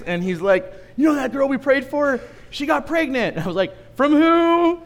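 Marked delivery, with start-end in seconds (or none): 1.0-3.4 high, louder eager retelling; excited explanation. Retold or imitated. Man explaining the pregnancy to the pastor, high and eager. 4.0-4.8 high, loud laughing yelp; stunned question. Retold or imitated. Pastor reacting to the awkward news, high laughing yelp.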